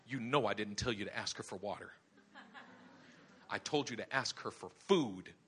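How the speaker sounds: God's understated scolding; stern God. Acted. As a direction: correct him firmly, low and measured. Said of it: "the Lord speaks to him and says".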